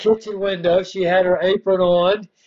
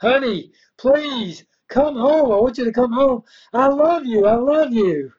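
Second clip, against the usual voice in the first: higher, begging husband on the phone; pleading husband; 7 semitones higher than usual. Retold or imitated. Husband begging his runaway wife on the phone, pleading higher. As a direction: beg her to come home. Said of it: "he would plead with her".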